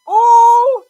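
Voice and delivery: loud, high imitated ooh of shock; mock gasp. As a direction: gasp like the whole class. Classmates' reaction to slap.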